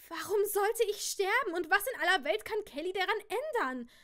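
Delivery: high, loud panicked questions. A narrator's reading. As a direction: voice full of rising panic. Sophie panicking.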